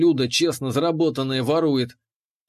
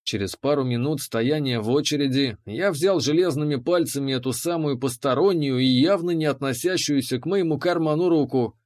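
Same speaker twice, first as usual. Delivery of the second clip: ordinary delivery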